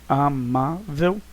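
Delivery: "amável" very slowly, split a - má - vel; syllable by syllable. Shown as a demonstration. Teacher showing the syllable division, "amável" syllable by syllable. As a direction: break "amável" into three separate syllables. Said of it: "separação silábica".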